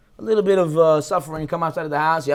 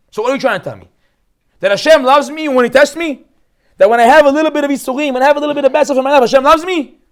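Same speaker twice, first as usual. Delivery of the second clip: much higher and louder, disbelieving; incredulous protest; 7 semitones higher, 9 dB louder than usual. Pretend. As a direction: throw the questions up in disbelief, fast on the middle line.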